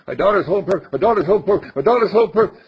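too fast. Shown as a demonstration. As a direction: blurt it quickly without thinking. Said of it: "You say it too fast".

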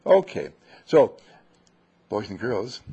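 ordinary delivery

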